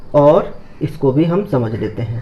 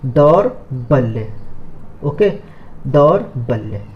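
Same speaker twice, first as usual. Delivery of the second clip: slow careful word; 4 semitones lower, slower than usual. Shown as a demonstration. Teacher explaining a word, slow drawn-out. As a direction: say दौर्बल्य very slowly, holding the ल्ल. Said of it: "word समझिए".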